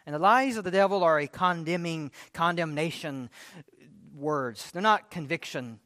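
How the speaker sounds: ordinary talk, nothing marked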